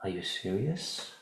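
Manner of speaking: soft, unhurried question, rising at the end; calm. Shown as a demonstration. Coach showing how to ask a partner calmly, calm. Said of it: "Calmly ask".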